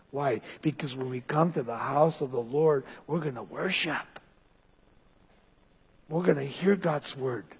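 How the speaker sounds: usual speaking voice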